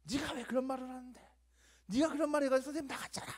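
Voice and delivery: high, fast accusing shout; angry classmates. Retold or imitated. Classmates accusing the boy after the teacher left, high and angry. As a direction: blame him like an upset kid. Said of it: "정말 화나는 표정으로".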